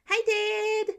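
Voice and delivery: put-on high, loud kid shouting Dad; bright boy calling across the kitchen; excited child call. Child calls out to father.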